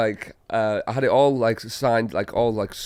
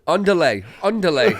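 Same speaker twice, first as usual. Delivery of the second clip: hammed-up fake Spanish, higher; mock Spanish; 7 semitones higher, 6 dB louder than usual. Jokey Spanish-ish chant.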